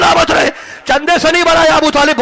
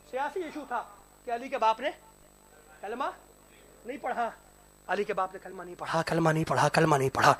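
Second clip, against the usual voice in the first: hushed, much softer murmur; very softly; 5 semitones lower, 17 dB quieter, faster than usual. Acted. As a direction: drop the voice almost to nothing. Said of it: "میں نے بڑے آہستہ سے کہا".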